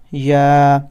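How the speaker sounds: normal talking voice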